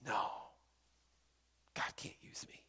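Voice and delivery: put-on very soft, near-whispered girl; retold hushed refusal. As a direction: withdraw into a tiny, defeated murmur. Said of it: "pulling her hands away and saying".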